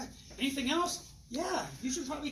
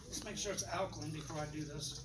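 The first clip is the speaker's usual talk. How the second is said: ordinary talk, nothing marked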